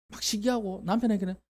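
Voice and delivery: usual speaking voice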